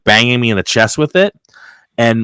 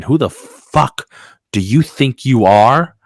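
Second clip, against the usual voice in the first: slow, drawn-out challenge, higher on the opening; indignant retold confrontation; 4 semitones higher, slower than usual. Worker tells off a coworker over lunch, indignant retelling. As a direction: act out squaring up to someone, word by word.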